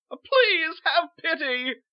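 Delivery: high, loud, drawn-out wail; pleading cry; desperate plea. Acted. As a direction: beg for mercy at full voice. Victim begs the guards.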